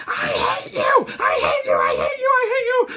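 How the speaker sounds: high, strained mock tantrum scream; screaming little girl. Little girl screaming at her mom in the car, high mock scream. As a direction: screech it like a hysterical child. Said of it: "she starts screaming".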